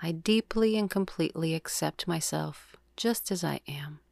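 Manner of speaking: calm, measured yoga nidra guide; soothing meditation voice, words evenly spaced; guided meditation. Acted. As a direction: lead the breath with long, unhurried pauses.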